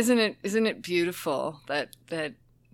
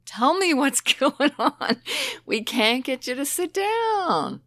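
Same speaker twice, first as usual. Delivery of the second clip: laughing coax; 8 semitones higher, 6 dB louder than usual. Retold or imitated. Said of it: "I said".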